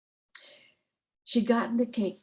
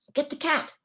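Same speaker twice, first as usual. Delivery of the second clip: higher, urgent royal order; urgent command; 6 semitones higher than usual. A narrator's reading. King orders the fairies to get the cat, urgent command. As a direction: call out a quick command, pitched up.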